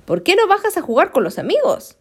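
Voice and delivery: high, bright call from the badger. Badger calling Kevin down to play: high friendly call. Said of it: "vino el tejón y lo llamó".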